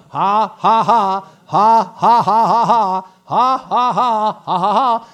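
loud, forced, spaced-out belly "ha"s; demonstrated laugh. Shown as a demonstration. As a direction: keep pumping out a defiant mock laugh. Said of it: "where you can stand and laugh".

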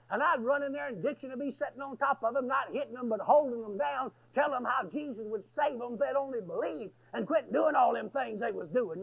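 ordinary talk, nothing marked

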